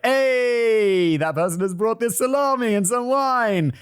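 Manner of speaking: breeze in proud of your salami; cheery arrival. Retold or imitated. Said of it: "and is like".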